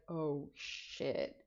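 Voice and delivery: low, soft oh shit; hushed dread. Retold or imitated. Teens realizing trouble, hushed low dread. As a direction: mutter it under your breath. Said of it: "and we're like".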